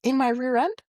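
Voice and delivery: high disbelieving rise; incredulous. Retold or imitated. Patient hearing the needle broke off, high shocked question. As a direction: shoot it up in shock.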